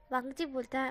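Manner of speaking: ordinary delivery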